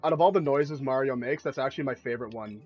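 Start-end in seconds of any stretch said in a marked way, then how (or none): none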